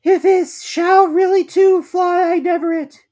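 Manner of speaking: very high, loud, slow squeal; jerky squeak, jolted word by word; Piglet. A narrator's reading. Piglet's jolted thought while bouncing in pocket.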